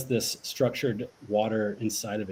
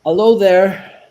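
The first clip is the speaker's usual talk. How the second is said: playful greeting; 6 semitones higher, 9 dB louder than usual. Pretend. Wordplay greeting to the plant.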